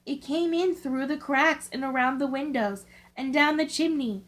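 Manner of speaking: usual speaking voice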